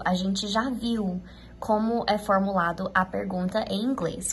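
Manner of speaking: ordinary talk, nothing marked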